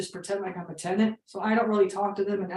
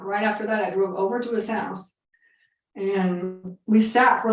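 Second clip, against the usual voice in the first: ordinary delivery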